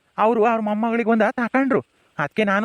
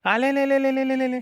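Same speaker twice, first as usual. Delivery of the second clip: high, drawn-out cooing lalala; delighted trill; 4 semitones higher, slower than usual. Acted. Grandfather hears baby say grandpa, delighted cooing. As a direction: trill playfully at a baby on one held high note.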